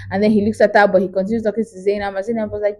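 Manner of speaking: usual speaking voice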